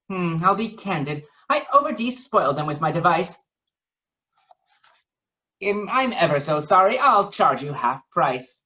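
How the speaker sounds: lightly voiced mock-sorry professor; a hint of a sheepish scientist; Professor Biscotti apologizing. Biscotti apologizes to Waffle, lightly voiced. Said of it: "Biscotti responded".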